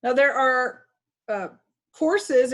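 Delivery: ordinary talk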